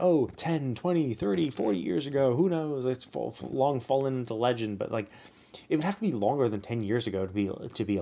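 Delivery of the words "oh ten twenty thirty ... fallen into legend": higher, lightly mocking imitation of them; mock character quote. Player mimicking game characters on a timeline, lightly mocking. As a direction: imitate them a little louder, pitched up. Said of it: "they were like".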